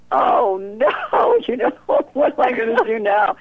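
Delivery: high, crying-edged, laughing "oh no"; mock dismay. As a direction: play up the helpless panic. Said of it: "And I just went".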